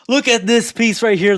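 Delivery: loud, high burst of excitement; too hyped. Real, not acted. Streamer showing off a gear piece, really hyped. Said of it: "I'm getting too hyped".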